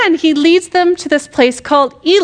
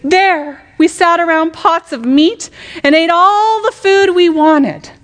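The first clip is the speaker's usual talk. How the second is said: dramatic whining Israelites; 6 semitones higher than usual. Acted. The Israelites complaining to Moses, dramatic whine. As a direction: moan it out like starving grumblers. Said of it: "I just feel like I have to say this dramatically".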